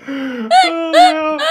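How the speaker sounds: helium laughter. Real, not acted. Helium laugh after inhaling.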